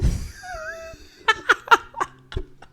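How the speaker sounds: loud, high-pitched laughing fit; helpless laughter. Real, not acted. Storyteller recalling being told off, helpless high laughter. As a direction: crack up into long, squealing laughter.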